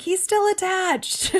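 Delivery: high, slow alarmed cry; alarmed warning. Retold or imitated. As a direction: stop her before she hands him over. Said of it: "everyone's like".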